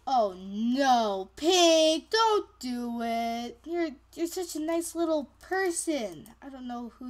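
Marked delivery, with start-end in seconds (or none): none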